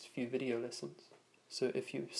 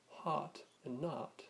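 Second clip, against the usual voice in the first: North American "heart and not", meaning hot, with open ah; North American accent; slower than usual. Shown as a demonstration. Teacher recapping North American vowels, American ah in "heart and not". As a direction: say "heart and not" as haht and naht, American style. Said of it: "North American style accent".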